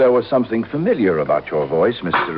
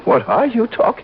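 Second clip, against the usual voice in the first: outraged protest; 12 semitones higher than usual. Acted. Accused killer protesting his innocence, alarmed high outburst. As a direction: cry out in alarmed denial.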